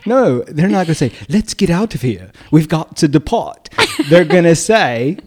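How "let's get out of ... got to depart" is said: put-on British accent, high-pitched. Insisting nobody escaping a fire talks like this, mock British accent. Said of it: "they are not gonna talk in a British accent".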